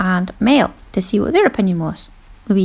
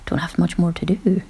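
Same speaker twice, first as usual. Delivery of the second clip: softer whispered thought; inner thought; 3 semitones lower, 8 dB quieter, slower than usual. Retold or imitated. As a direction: whisper it to yourself.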